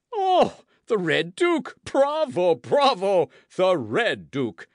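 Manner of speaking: high, loud burst of applause; delighted cry. A narrator's reading. Porthos applauds Aramis's joke, delighted cry. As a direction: cry bravo with big, booming delight. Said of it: "cried Porthos, clapping his hands".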